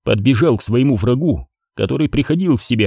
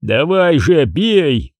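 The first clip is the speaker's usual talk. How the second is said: shouting; 3 semitones higher, much slower than usual. Acted. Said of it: "крикнул ей Крис".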